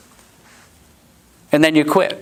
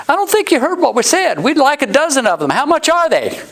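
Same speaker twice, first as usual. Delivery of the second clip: high, insistent mock customer; front-row gentleman insisting on the price; impatient customer; 14 semitones higher than usual. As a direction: press him for the price. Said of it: "gentleman in the front row says".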